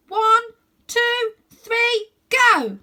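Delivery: high, loud countdown; two children shouting before a leap; counted shout. A narrator's reading. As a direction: count down to the jump.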